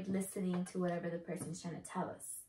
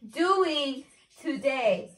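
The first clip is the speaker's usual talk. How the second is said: half-speed, louder, word by word; slow exaggerated enunciation; 2 semitones higher, 6 dB louder, much slower than usual. Real, not acted. Partner guessing between words, slow exaggerated enunciation. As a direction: mouth each word for a lip reader. Repeats it for lip reader.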